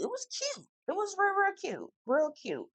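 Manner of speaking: high, airy gush; breathy sing-song approval, high and soft; gushing high praise. Acted. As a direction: coo over it like a cute find. Gushing over the outfit.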